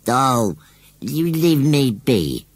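high, loud, slow rustic voice; sulky scarecrow. A narrator's reading. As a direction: grumble stubbornly as a country scarecrow. Sulky scarecrow snaps at teasing boy.

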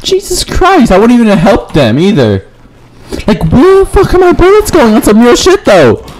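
high-pitched exasperated rant; angry ranting. Real, not acted. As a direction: rant at the game, voice jumping high. Raging at players and missed shots.

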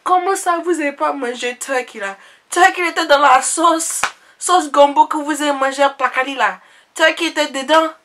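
high, loud Ivorian auntie, indignant. Retold or imitated. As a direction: play the baffled auntie, pitch way up. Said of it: "She's like".

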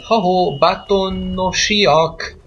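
playful calling out. Pretend. Player hails the Badtown townsfolk, playful calling out. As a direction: call the villagers out, stretching the words.

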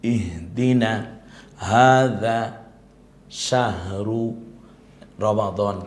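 ইহদিনা and শাহরু drawn out slowly; slow Quranic recitation. Shown as a demonstration. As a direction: recite each word long and measured. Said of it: "যেটা কোরআনে আল্লাহ বলেছে". (ধীর তিলাওয়াতের সুরে)

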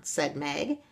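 usual speaking voice

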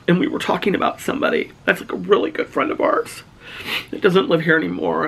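choked up, high voice breaking into breath; real teary-eyed. Real, not acted. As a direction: talk through rising tears. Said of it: "I'm gonna get teary-eyed again".

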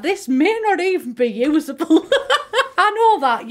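laughing through the words. Real, not acted. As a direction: giggle while admitting it. Laughs at own building.

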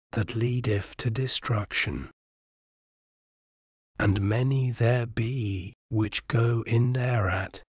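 normal talking voice

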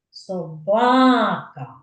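Собака said slowly with ба stretched and stressed; stress on the syllable. Shown as a demonstration. Teacher showing children the stressed syllable, slow demo. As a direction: draw out the ба in Собака. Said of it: "Ви чуєте, що я виділяю?".

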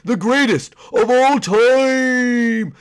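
slow, high, loud proclamation; slow loud heroic declaration; grand boast. Acted. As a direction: proclaim it like a legend. John Wick boasting grandly.

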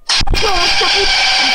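high, slow shouted curse; explosive italian cursing. Real, not acted. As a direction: cry it out in shock. Caught again. (imprecazione urlata)

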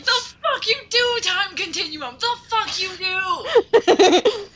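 high frantic raging gamer shriek. Pretend. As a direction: scream at the game like a raging gamer. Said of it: "I will become a raging gamer".

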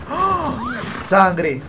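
sudden loud startled cry; startled yell. Real, not acted. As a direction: jump at the sight. Sudden blood on screen. (grito de sobresalto)

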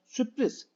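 Sürpriz said high as süpriz without the first r; teacher mimics the careless Sürpriz; wrong form. Shown as a demonstration. Teacher to pupils, imitating careless Sürpriz. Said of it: "Ağzımızdan bu şekilde çıkıyor".

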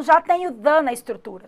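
ordinary talk

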